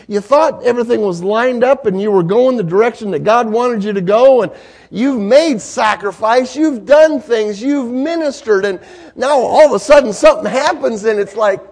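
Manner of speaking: loud, high sermon build; full-force preaching. Acted. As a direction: preach it with rising passion.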